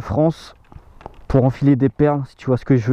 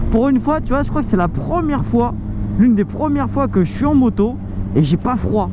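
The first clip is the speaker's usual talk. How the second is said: overjoyed; 7 semitones higher than usual. Real, not acted. Biker telling friends about the lovely warm ride, overjoyed. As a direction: gush happily, stretching the key words. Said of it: "comment je suis trop content".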